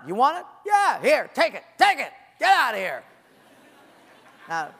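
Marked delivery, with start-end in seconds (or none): 0.1-3.0 louder, exasperated giving in; fed-up dad. Retold or imitated. Father giving in to nagging child, loud and fed up. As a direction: shove it at them, annoyed.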